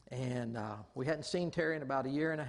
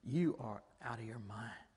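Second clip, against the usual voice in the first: disbelieving glare; 5 semitones lower, 4 dB quieter than usual. Retold or imitated. Mother glaring at the pastor testifying against her, disbelieving. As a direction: imitate her icy look in words. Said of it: "she's looking at me like".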